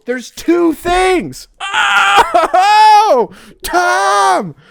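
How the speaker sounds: high, loud, slow cry-like shouting; calling out to Tom; overwhelmed shout. Real, not acted. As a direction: near tears with excitement.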